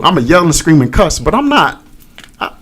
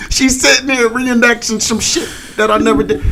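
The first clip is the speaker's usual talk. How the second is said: mock crying; 9 semitones higher, 3 dB quieter than usual. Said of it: "I started crying".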